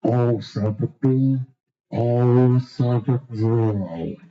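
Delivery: put-on low, drawn-out old-timer bingo call; bingo caller impression. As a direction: drag out oh seventy like a fair caller. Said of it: "give to homage to my favorite bingo caller".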